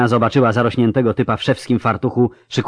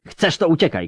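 shout; 7 semitones higher than usual. Acted. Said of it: "krzyknęła".

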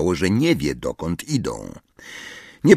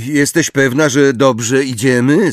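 pressing question, pitched up and louder; insistent; 6 semitones higher, 5 dB louder than usual. A narrator's reading. Harry questions Hermione about the route, insistent. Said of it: "zapytał ją z naciskiem".

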